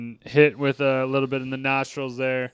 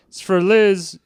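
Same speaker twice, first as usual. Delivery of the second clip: higher, louder acted call-out; 7 semitones higher, 6 dB louder, slower than usual. Coworker passes Shaun a phone call, calling out. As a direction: call it across as if passing on a phone call.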